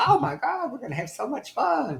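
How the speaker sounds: high, squealing mock Leslie Jordan shriek of joy; gleeful scream. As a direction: imitate a friend squealing with delight. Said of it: "he just screamed".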